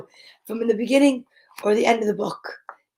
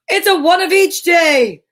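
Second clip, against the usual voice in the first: excited; 5 semitones higher, 5 dB louder than usual. Real, not acted. Reveals vitamins, delighted.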